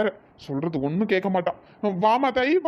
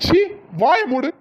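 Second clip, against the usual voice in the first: louder, sharp command; angry shout; 6 semitones higher, 5 dB louder than usual. Acted. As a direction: shut her down. Husband snaps at her.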